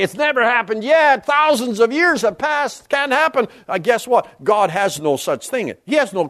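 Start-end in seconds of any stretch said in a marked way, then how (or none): 0.0-3.5 mock scoffer. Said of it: "So you may say".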